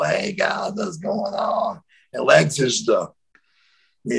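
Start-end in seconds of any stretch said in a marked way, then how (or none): 0.0-1.8 light, high singsong hello; easygoing happy-guy greeting, lifting high. Retold or imitated. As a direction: chirp it softly, drawing out the end. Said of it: "I'm the happy Marcus Bagwell".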